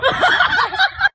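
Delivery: a high burst of real laughter; a loud, high giggle breaking through; sudden laugh. Real, not acted. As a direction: crack up mid-story. Narrator cracks up at the story.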